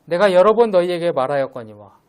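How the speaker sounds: solemn public reading of the Bible; scripture reading. Acted. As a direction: proclaim the verses to the congregation. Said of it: "제가 읽어드리겠습니다".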